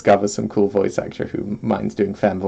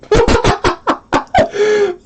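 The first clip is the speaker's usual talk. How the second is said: high burst of laughter; 4 dB louder than usual. Real, not acted. Laughing at own attempt.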